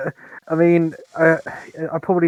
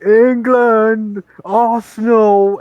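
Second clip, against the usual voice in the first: loud, higher, drawn-out football chant; mock chav yell; 8 semitones higher, 6 dB louder, much slower than usual. Imitating pub football fans every night, slow loud chant. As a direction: holler it like fans after the match. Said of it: "hear all the chavs yelling".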